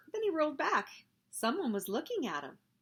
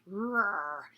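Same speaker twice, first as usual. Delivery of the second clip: mock rocket roar. Bill plays a rocket man, lightly roared. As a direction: growl it like a toy rocket.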